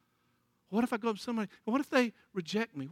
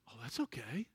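gentle woman reply; 9 semitones lower, 13 dB quieter than usual. Retold or imitated. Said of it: "the woman goes".

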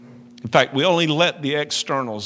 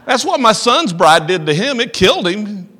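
higher, louder playful voice of God; retelling God's reply with mock cheer; God's voice, hammed up; 7 semitones higher, 8 dB louder than usual. God explaining, retold.

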